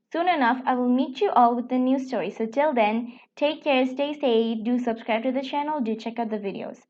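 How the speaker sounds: plain reading voice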